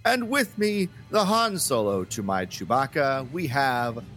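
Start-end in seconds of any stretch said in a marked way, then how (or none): none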